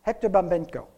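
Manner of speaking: ordinary talk, nothing marked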